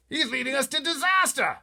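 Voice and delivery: pompous officer aghast; panicky Air Force chief. Acted. Air Force head crying out to the pilot in alarm. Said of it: "the head of the Air Force cried".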